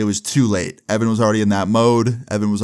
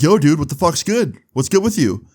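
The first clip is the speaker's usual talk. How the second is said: imitating a hostile guy, higher and louder; aggressive confrontation; 7 semitones higher, 3 dB louder than usual. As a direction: get in his face, challenging. Angry stranger confronts Evan.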